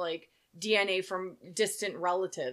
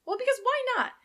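high excited gush; 9 semitones higher than usual. Real, not acted. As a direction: squeal it out excitedly.

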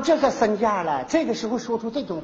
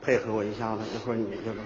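hushed, much lower scheming aside; conspiratorial low aside; 12 dB quieter, much faster than usual. Acted. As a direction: lean in and plot it quietly.

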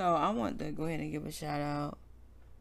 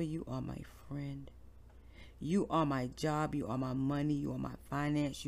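heartfelt prayer; 5 semitones lower, 7 dB quieter than usual. Real, not acted. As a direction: pray aloud gently, like leading a congregation. Leads a prayer on air.

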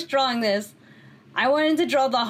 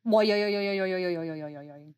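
rolling yo from the throat, fading lower; throaty yo sound effect; 7 semitones lower, 4 dB quieter, faster than usual. Shown as a demonstration. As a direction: roll the throat, yo yo yo. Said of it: "my favorite sound effect".

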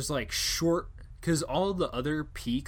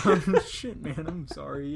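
mock sheepish apology; 3 semitones higher, 4 dB louder than usual. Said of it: "And he's like".

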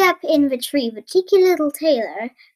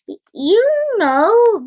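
high, loud, drawn-out bellow; 8 semitones higher, 6 dB louder, slower than usual. Acted. First giant accuses sleepy brother, bellowing. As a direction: bellow it slowly at your brother. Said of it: "bellowed the first giant".

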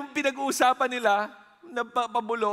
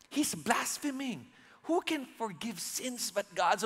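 mock-whispered, softer; hushed whisper; 7 dB quieter than usual. Pharisees muttering to each other behind Jesus, hushed. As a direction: say it under your breath like a secret complaint. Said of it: "So medyo pabulong to".

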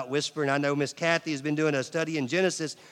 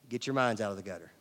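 normal talking voice

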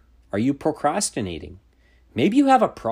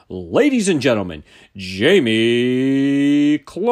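booming, drawn-out ring announcer call; fighting game announcer; 6 dB louder, slower than usual. Shown as a demonstration. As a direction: stretch the name out like a fight intro. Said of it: "I'd like to show you my announcer voice".

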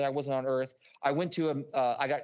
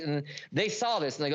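ordinary talk

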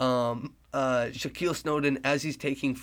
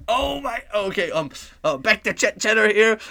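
much higher, louder startled call; excited announcer; 8 semitones higher, 7 dB louder than usual. Acted. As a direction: blurt the surprise on air. Announcer reacting to shots made.

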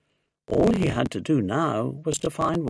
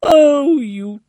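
loud, high, drawn-out gushing; delighted cry; 7 semitones higher, 10 dB louder, much slower than usual. A narrator's reading. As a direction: coo loudly over an adored pet. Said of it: "she cried".

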